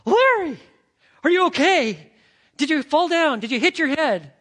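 high, loud, worried calling; alarmed mother. Retold or imitated. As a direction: call through the door as a frightened mom. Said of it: "his mom comes running up".

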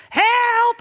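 put-on very high, loud toddler scream; screaming for help. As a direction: scream it like a trapped small child. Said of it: "You start screaming".

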